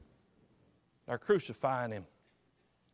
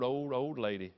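usual speaking voice

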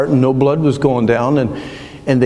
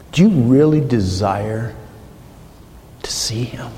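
whispered challenge; 3 semitones lower than usual. Acted. Preacher asking the congregation their desire, whispered. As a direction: ask it, then whisper to see him.

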